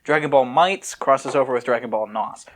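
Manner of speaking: ordinary delivery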